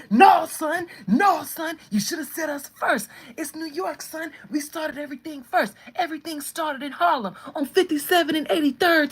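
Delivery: loud, high, hot-headed ranting; aggressive New York woman. Acted. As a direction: cut in shouting like an indignant New Yorker. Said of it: "And that's the woman".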